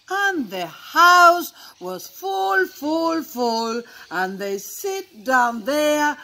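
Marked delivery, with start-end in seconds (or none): none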